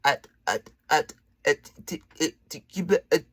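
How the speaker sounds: stuttering, blocked sounds; mock stammer. Shown as a demonstration. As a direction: act out a tongue-tied stutter. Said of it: "difficulties communicating".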